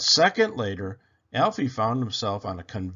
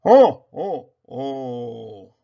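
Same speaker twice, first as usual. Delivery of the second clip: much louder jolly Santa laugh; 10 dB louder, much slower than usual. Acted. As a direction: boom it like Santa. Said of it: "Santa's laugh closing story".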